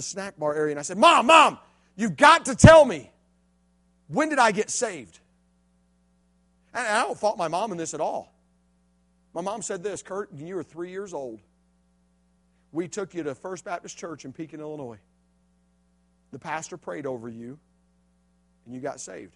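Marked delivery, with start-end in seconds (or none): 1.0-5.2 loud, slow, pressing; urgent plea. Retold or imitated. Urgent question to mom. 10.0-19.3 mom's calm answer. Retold or imitated.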